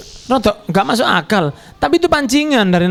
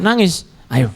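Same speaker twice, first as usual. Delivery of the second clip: ordinary talk, nothing marked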